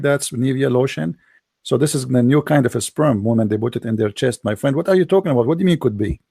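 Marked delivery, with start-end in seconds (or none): none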